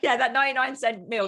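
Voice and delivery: loud, giggly and amused; laughing. Real, not acted. Guest laughing along with the host about the meal, laughing. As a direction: laugh as you say it.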